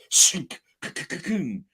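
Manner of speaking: vocal sound effect with stuttered k's; imitated mechanical noise. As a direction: spit out a choppy sound effect. Imitating noises in retold scene.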